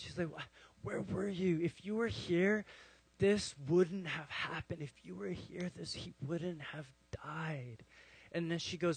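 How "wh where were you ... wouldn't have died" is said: mock-tearful plea, higher and mostly softer; lightly acted wailing reproach, trailing off softer; tearful complaint, imitated. Martha complaining to Jesus after her loss, mock tearful. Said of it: "She complains".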